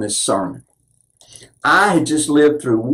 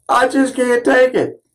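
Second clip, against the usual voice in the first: anguished cry quoted; 8 semitones higher than usual. Retold or imitated. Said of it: "he would cry out in anguish".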